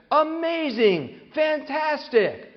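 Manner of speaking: very slow, high cheesy announcer; app cheer voice. Retold or imitated. Word-game app praising each word, slow high cheesy cheer. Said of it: "the app would say things like".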